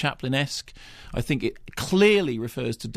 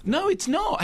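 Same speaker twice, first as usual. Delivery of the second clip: indignant denial; slower than usual. Real, not acted.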